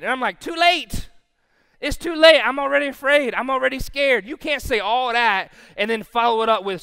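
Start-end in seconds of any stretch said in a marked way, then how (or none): 0.4-4.2 loud, high mock alarm; comic outburst of fear; panicked retort. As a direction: protest at high volume as if scared stiff. Acting out panicked retort to scripture.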